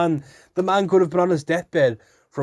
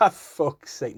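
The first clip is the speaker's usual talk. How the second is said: real burst of laughter, then a muttered fuck's sake; cracking up over a fluffed word; laughing at himself; 6 semitones higher than usual. Real, not acted. Vlogger laughs at his flub mid-recording. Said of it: "laughs at own slip".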